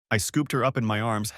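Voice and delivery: usual speaking voice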